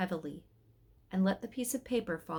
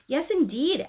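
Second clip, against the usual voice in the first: high, loud, huffy agreement; indignant agreement; 8 semitones higher, 6 dB louder than usual. Acted. Cherub backs the angel against the wise men, indignant. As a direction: chime in with offended emphasis.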